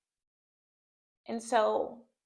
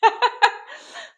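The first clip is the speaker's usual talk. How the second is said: teary sigh; 11 semitones higher, 11 dB louder than usual. Real, not acted. Composing herself after tearing up.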